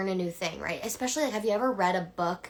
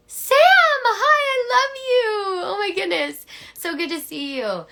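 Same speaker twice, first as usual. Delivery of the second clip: very high, loud, squealing greeting; delighted squeal; 12 dB louder than usual. Real, not acted. Coach greeting Sam in the live chat, squealing. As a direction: gush over a friend who just showed up.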